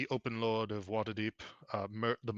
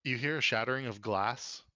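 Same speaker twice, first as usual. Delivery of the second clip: ordinary delivery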